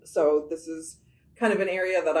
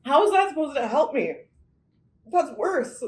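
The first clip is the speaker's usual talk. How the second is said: incredulous; 8 semitones higher, 5 dB louder than usual. Real, not acted. Burning mouth from hot sauce.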